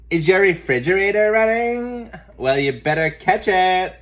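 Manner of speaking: high, loud giggly prank-caller voice. Acted. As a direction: tease the stranger on the phone. Muffy prank-calls strangers.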